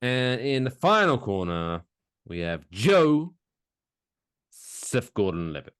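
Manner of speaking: big fight-night introduction; ring announcer. Acted. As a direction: drag out the names like a ring announcer.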